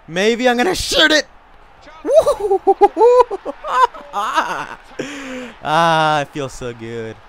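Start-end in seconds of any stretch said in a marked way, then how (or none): 0.1-1.3 high, loud rising excitement; excited yell. Real, not acted. Gamer going for the shot, high excited yell. 1.9-5.6 long, high "woo" into laughter; elated whooping laugh. Real, not acted. Gamer celebrating the shot going in, high whooping laugh.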